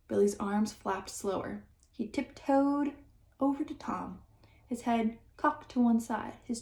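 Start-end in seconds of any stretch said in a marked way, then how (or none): none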